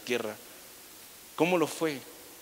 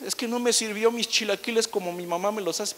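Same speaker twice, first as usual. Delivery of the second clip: higher mock whine over chilaquiles; spoiled husband complaining about breakfast, mock whine; petty complaint; 5 semitones higher than usual. Mocking spouse's petty complaint.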